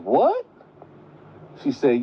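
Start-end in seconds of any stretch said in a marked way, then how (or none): 0.0-0.4 very high startled squeak; the girl hearing who her real mother is, a high shocked "what". Retold or imitated. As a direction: gasp it in disbelief. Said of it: "She like".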